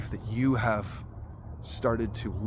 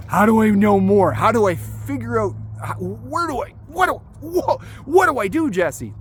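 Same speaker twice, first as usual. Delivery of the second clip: frantic mock viewer; 9 semitones higher, 8 dB louder than usual. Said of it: "that you've been like".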